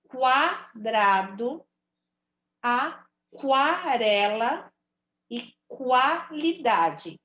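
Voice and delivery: each word slowly stretched with the kw sound clearly heard; slow teaching read. Shown as a demonstration. Teacher showing pupils that the U is heard, slowly. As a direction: draw out quarteto, quarto, quadrado, aquarela, qualidade, sounding the U. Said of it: "Eu ouço o som do U".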